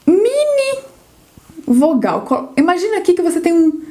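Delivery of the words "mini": "mini" squeaked up high; high-pitched mini. Shown as a demonstration. Teacher picturing a tiny vowel for learners, high-pitched.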